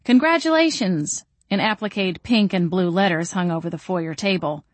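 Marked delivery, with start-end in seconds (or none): 0.0-1.2 high, celebratory announcement; banner shouting. A narrator's reading. Reading out a handmade baby shower banner, mock shouting. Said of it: "banner shouting".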